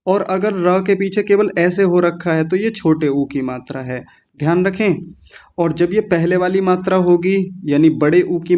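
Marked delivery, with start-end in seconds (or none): none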